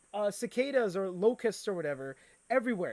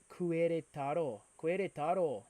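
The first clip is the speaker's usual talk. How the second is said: Mexican-accented place name, tried out carefully; attempted Mexican accent. Shown as a demonstration. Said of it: "the Mexican accent, but you guys say the words like that".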